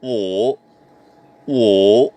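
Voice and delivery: WO low, dipping then rising; "wo" in a long falling-rising third tone; Mandarin pronunciation. Shown as a demonstration. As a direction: scoop "wo" down and back up. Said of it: "Number five".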